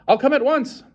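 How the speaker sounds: high, loud hurried answer; urgent. A narrator's reading. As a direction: promise at once. Urgent reply on phone.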